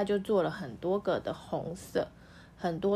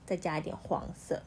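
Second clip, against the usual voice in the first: ordinary delivery, nothing marked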